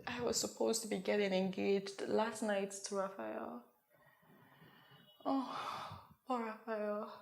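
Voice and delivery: very soft, subdued confession; rueful pity. Acted. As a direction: sigh over him, barely audible. Guilt over fiancé.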